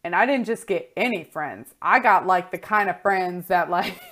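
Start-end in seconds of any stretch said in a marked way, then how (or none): none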